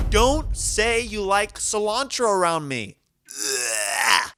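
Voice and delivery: high, whiny voice of disgust; disgusted high rant. Acted. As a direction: whine about it, high and disgusted. Ranting against cilantro, high put-on voice.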